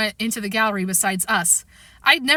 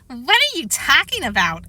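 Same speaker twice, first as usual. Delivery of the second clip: higher, singsong mocking echo; teasing parrot voice, pitched up; mocking mimicry; 4 semitones higher than usual. A narrator's reading. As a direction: mimic the question to tease. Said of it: "Alex mimicked".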